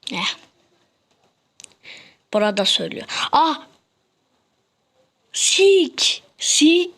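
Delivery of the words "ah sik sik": startled cry. Real, not acted. Sudden fright.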